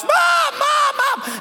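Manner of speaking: put-on high-pitched panicked kid's yell; acted frantic calling out for Mom; freaking out. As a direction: cry out like a panicking teenager. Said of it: "he's freaking out".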